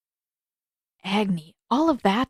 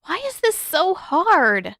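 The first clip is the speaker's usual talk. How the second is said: high, slow exasperated complaint; high drawn-out whine of frustration; frustrated whine; 9 semitones higher, 4 dB louder, slower than usual. Acted. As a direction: whine it out, fed up. Katara frustrated with firebending.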